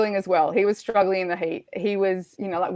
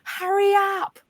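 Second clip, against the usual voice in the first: high, drawn-out mock yell; retold scream; 12 semitones higher, slower than usual. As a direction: imitate a woman yelling from afar, without full volume. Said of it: "I hear this woman screaming".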